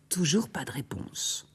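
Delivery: whispered suspense narration; hushed. A narrator's reading. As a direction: let the silence hang. (chuchoté, en suspense)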